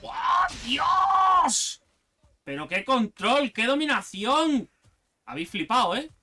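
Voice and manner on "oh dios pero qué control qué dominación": loud, drawn-out awe; amazed outcry. Real, not acted. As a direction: gasp out in wonder. Amazed by the view.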